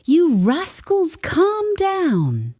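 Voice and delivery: high, mock-stern call; playful scolding. Shown as a demonstration. Learner scolding family, high playful mock-stern call. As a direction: tease the kids into calming down. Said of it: "Practice this with family or friends".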